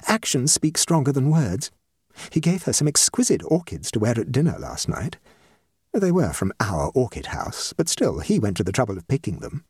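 lightly voiced proud mother; eager mother. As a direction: play a mildly eager matchmaking mother.

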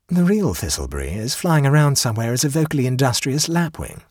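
ordinary delivery

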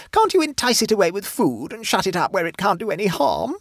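high, alarmed plea; panicked screaming. A narrator's reading. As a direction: beg for help in a high, frightened voice. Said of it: "screamed Mavis".

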